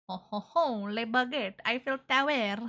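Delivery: hammed-up fake French ad voice; a hint of fake French, lightly voiced; mock French accent. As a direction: quote the commercial in cheesy French. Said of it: "saying a bunch of French words".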